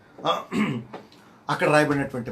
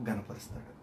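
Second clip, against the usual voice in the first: whispered punchline; 4 semitones lower, 12 dB quieter than usual. Real, not acted. Preacher telling the congregation, sudden whisper. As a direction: whisper the last word, much softer.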